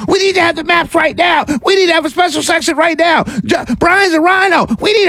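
high shrill mock yelling; mock screaming crazies. Mocking the crazies yelling for maps now. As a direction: shout the demands like a tantrum. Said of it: "they're yelling and screaming".

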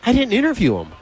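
high-pitched retold disbelief; alarmed protest. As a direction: protest as if caught off guard. Acted protest to the recruiter.

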